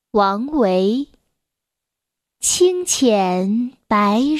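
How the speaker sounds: very slow, drawn-out classical verse reading; poem recitation. Acted. Reading Wang Wei's Tang poem to children, slow recitation. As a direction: stretch each syllable, pause between phrases.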